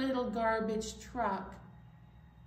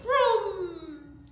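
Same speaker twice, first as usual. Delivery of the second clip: loud roaring engine vroom; 6 semitones higher, 10 dB louder than usual. Pretend. Reading to kids, the truck roars off loud. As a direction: rev it up big.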